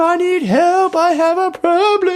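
very high, sustained self-pitying wail; mock crying. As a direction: imitate yourself bawling down the hallway. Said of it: "crying down the hallway".

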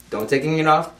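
usual speaking voice

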